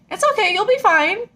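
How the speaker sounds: put-on high, bright-eyed kid reassuring a grown-up; little kid voice. Kid on the ride telling her she'll be fine, high child voice. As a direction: pitch way up like a confident child. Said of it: "this little kid's like".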